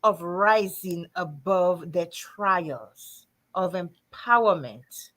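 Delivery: slow, weighty delivery; slow emphatic list. Real, not acted. Host describing the anthology, slow and weighty. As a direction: lay each phrase down slowly.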